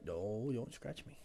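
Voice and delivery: ordinary delivery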